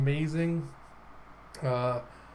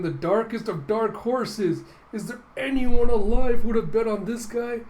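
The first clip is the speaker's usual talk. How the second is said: amazed announcer; 8 semitones higher, 5 dB louder than usual. Acted. Announcer comments on upset.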